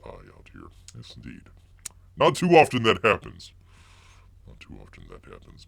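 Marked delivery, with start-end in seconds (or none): 4.5-5.6 a quiet mournful echo to himself; softer muttered echo. Acted. Host echoing his own lament to viewers, softer mutter. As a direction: repeat it much softer, under the breath. Repeats the line, second time muttered.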